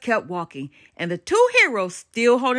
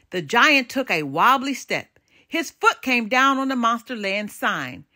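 usual speaking voice

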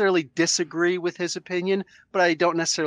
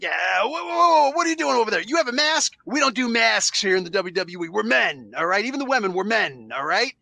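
blustering wrestling boss; Vince McMahon; 4 semitones higher than usual. Acted. Boss objecting to masks among his wrestlers, mock blustering. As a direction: put on an indignant boss, leaping high on the whoas. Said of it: "Let's do my Vince McMahon voice".

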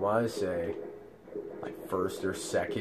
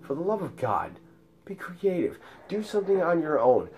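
higher-pitched, fed-up pleading; exasperated plea; 8 semitones higher than usual. Retold or imitated. Him to the unoriginal teasers, exasperated high plea.

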